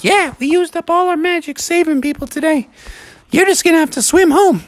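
high-pitched storybook creature voice; squeaky-high chorus member; other narwhals. A narrator's reading. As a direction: chime in as one of the magical narwhals, way up high. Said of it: "all the other ones were like".

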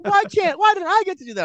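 put-on very high-pitched kid protest; imitated child's complaint.

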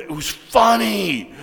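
high, loud, drawn-out delight; elated exclamation. Retold or imitated. Writer receiving his co-writer's joke, elated exclamation. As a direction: stretch it out in triumphant relief.

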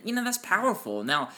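ordinary talk

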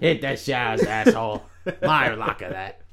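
low, put-on grumpy trainer voice; Mickey. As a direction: send him off like a cranky American coach. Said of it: "That's how Mickey talks".

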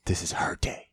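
breathy hissed threat, rising high; menacing whisper with a laugh; whisper yelling. Retold or imitated. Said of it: "whisper yelling".

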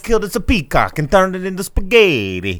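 loud, high, blustering cartoon Italian; mock Italian accent. Host parodying the father in the trailer, mock Italian accent. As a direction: shout it like a hammed-up Italian papa. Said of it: "talk about it the way they talk about it".

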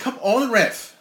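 high, loud yell at the ref; yelling from the sidelines. Real, not acted. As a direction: heckle the referee from the crowd. Yelling at the referee on screen.

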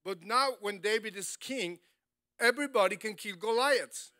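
loud, rising preacher's cry; full-throated sermon build; preaching at full force. Acted. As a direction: preach it big to the church.

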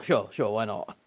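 usual speaking voice

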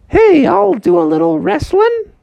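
high, put-on elephant voice. Elephant offering a wrestle, high voice.

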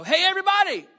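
shouts "Hey, everybody!" high and loud; calling out. Retold or imitated. Pastor calling the connect group together, loud shout. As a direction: call the whole room to attention. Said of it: "I go".

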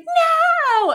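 sudden high yelled no; child's shrill no. Retold or imitated. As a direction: shout it like a startled kid. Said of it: "Louise was like".

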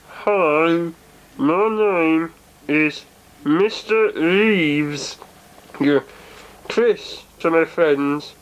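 slow, low, measured character voice; Mister Reeves voice. Acted. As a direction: introduce yourself slowly and deeply. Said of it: "that was Chris".

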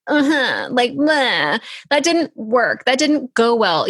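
whiny voice. Shown as a demonstration. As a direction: whine it like a sulky kid. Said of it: "I'm using my whiny voice".

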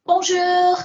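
high, excited greeting. Real, not acted. Co-host greeting listeners in French, excited. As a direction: blurt out a high, excited hello. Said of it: "I got excited".

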